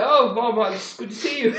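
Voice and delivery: high-pitched mock English greeting; put-on English accent. Greeting Mom after her complaint, put-on English accent. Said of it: "talking like an English person".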